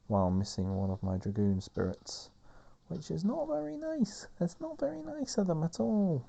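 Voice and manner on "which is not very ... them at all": high mock whine. Mock-upset game complaint.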